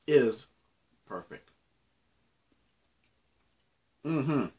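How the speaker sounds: chewing while talking; talking between bites; mouth full of candy. Real, not acted. Seen 1: Holds small candy piece, jaw working between bites while talking.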